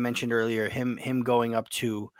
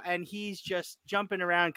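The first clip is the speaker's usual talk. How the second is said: ordinary delivery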